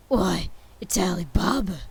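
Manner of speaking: loud, delighted outburst of recognition; ecstatic exclaim. A narrator's reading. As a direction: cry it out in pure joy. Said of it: "Scrooge exclaimed in ecstasy".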